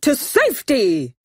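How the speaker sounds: put-on storybook rallying cry; a contained shout in a character voice; mild shout. A narrator's reading. Narrator voicing the friends fleeing to safety, mild mock shout. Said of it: "they shouted".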